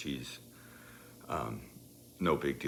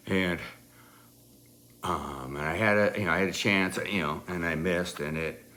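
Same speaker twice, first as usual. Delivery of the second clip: usual speaking voice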